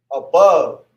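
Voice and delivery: usual speaking voice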